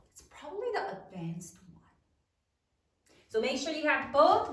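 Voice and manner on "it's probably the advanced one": ordinary talk, nothing marked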